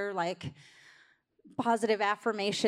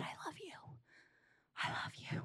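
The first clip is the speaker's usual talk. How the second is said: hushed, adoring whisper of love; tender whisper; 8 semitones higher, 16 dB quieter than usual. Pretend. Parent whispering love over a child who feels flawed, hushed and tender. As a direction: breathe it softly to a child you adore.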